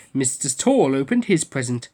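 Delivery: plain reading voice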